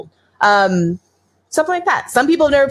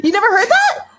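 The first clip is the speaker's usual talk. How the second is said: high, laughing astonishment; amused disbelief; 11 semitones higher than usual. Real, not acted. Co-host learns he never heard the joke, incredulous. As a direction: pitch leaping up into the question. Surprised friend doesn't know the saying.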